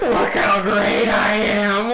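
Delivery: slow, high imitation of a boast; mocking echo. Friend echoing the ex-girlfriend boast, mocking and drawn out. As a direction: draw it out high, mimicking a deranged show-off.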